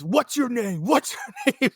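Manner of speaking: high-pitched mock demand; hammed-up heated questioning; angry demand. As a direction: imitate an irate man demanding an answer. Retold guy demanding a name.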